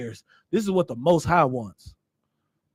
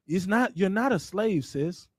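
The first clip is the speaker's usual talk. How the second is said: normal talking voice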